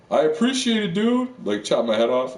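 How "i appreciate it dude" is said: high mock thank-you; mock cheerful gratitude to his killer, high voice; mock grateful. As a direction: gush up high like it's a favor. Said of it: "You know, like".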